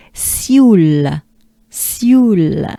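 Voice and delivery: "sioule" like soul with an E inside, French; slow, drawn-out French sioule; French pronunciation demo. Shown as a demonstration. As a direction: model sioule with a long falling vowel. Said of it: "like soul, but with a French accent".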